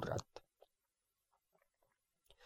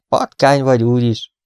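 fuming; 3 semitones higher, 6 dB louder than usual. A narrator's reading. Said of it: "mérgelődött mister Amateus".

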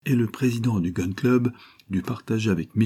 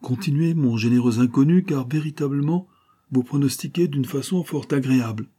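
ironique; 6 semitones higher than usual. A narrator's reading. Ardan raille l'inconnu avec ironie.

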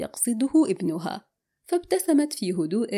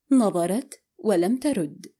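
plain reading voice

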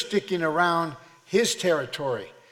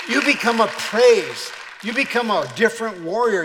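defiant, raised-voice sermon testimony; full-force preaching; 2 semitones higher, 5 dB louder than usual. Acted. Preacher telling the devil what has been learned, full-force preaching. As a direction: build in volume and drive each line home.

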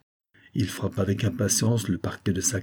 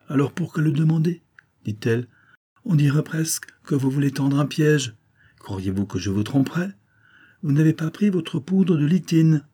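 lightly raised, wide-eyed wife; a wife turning the question back on him; a hint of an offended wife; wife's voice; 6 semitones higher than usual. A narrator's reading.

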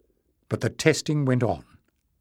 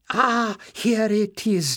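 put-on higher, slow old librarian finding the page; eager cry of discovery; 9 semitones higher, slower than usual. Old librarian finds the book, a slow high cry. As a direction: draw out the find, delighted. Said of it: "Joseph cried".

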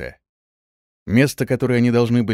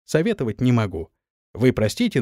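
usual speaking voice

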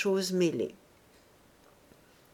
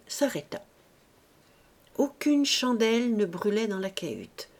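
ordinary delivery

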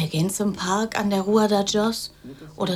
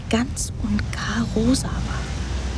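verträumtes Flüstern; slower than usual. Acted. Schwärmt von Marilyn.

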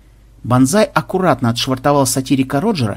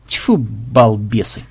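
slow, lower grumble of contempt; disgusted aside; 4 semitones lower, much slower than usual. Acted. Pirate dismissing the flagship's crew afterward, slow disgusted mutter. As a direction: spit it out slowly to yourself. Ворчит после переговоров.

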